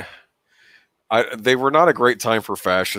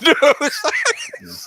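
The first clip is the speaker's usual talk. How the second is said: breaking into laughter. Real, not acted. Host breaking up over the no-good-news joke, laughing. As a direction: laugh climbing high through the last word.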